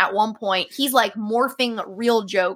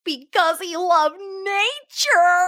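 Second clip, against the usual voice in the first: put-on high, slow, louder kid's punchline; little boy's punchline; 10 semitones higher, 5 dB louder, much slower than usual. Retelling a five-year-old's punchline, high slow kid voice. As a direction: play the proud little boy stretching each word. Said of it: "this is what he sounded like".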